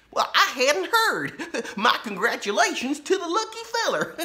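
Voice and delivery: put-on high chuckling old sheriff voice; nervous laughing. A narrator's reading. Laura's dad laughs nervously at the outlaw.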